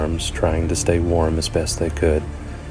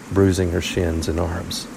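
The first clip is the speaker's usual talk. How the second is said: usual speaking voice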